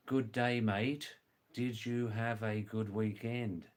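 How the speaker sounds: "Good day, mate. Did you have a good weekend" with every word separate; clear translation. Shown as a demonstration. Teacher translating Aussie greeting for learners, clear careful English. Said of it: "Now, I'll translate that".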